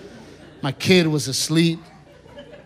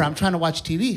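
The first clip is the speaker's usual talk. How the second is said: ordinary talk, nothing marked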